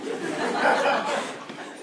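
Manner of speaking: long, airy laugh; real burst of laughter. Real, not acted. Amused amazement at student's answer.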